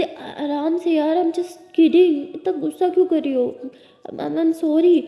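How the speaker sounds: calm her down while pleading; flustered backpedal. Acted.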